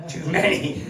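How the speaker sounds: normal talking voice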